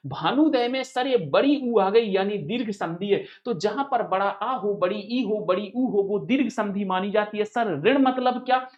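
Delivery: ordinary talk, nothing marked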